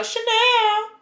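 CHANEL sent up high and drawn out; playful show-off repeat. Pretend. Rich friend bragging about shopping, CHANEL said high and showy.

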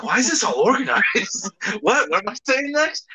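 high, bewildered mock panic at the organized setup; baffled. Recalling the guest's podcast, mimicking his own high baffled panic. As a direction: play your own confusion back as a high, flustered squeak. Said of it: "I was like".

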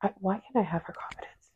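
breathy whispered aside; whispering. Real, not acted. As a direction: whisper it like a secret wish. Wistful aside.

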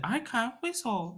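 cartoon girl voice. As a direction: pout it out high and slow. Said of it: "this girl is like".

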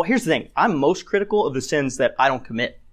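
normal talking voice

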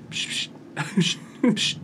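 soft hissing psch bursts, last ones whispered; imitated peeing sound. Teasing a friend about his peeing, a hissed trickle sound. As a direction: hiss out a weak trickle of pee. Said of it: "wir machen das Geräusch jetzt mal vor".

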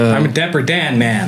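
slow, put-on movie-character voice; lightly voiced Southern film hero; film quote voice. Quoting line from the film.

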